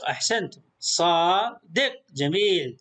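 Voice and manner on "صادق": slow صادق as "saa ... deq"; long alif, split. Shown as a demonstration. Teacher shows madd and closed syllable, slowly split. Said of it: "لاحظ هنا مد بالألف".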